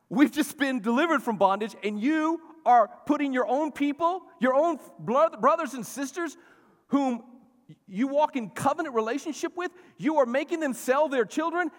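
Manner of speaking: high, loud outraged rebuke; angry yelling. Retold or imitated. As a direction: rebuke them as Nehemiah. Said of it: "He was yelling at them".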